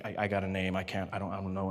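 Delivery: low, muttering confused man; confused official. Retold or imitated. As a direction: mumble it low and puzzled.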